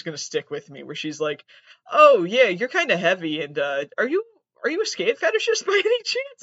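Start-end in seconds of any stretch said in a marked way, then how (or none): 1.9-6.4 high, loud, mocking imitation of her; sing-song high parody of a nosy customer. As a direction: squeal her nosy question back in a silly high voice. Mocking woman's quoted question.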